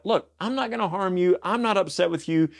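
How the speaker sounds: light mock David reassuring the king. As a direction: play David as harmless, mildly. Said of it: "what David's saying is like".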